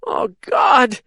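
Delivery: high, strained croak. A narrator's reading. Wounded Caitlin croaks in despair. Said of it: "she croaked".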